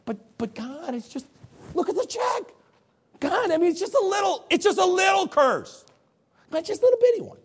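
very high, whiny pleading voice; wheedling Balaam. Acted. Balaam begging God to allow a little curse, high-pitched wheedling. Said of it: "I can just see Balaam going".

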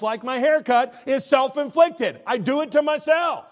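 booming pulpit exhortation; preaching at full force. Acted. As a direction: lift the room, preach it out. Preacher raising voice.

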